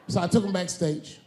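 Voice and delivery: usual speaking voice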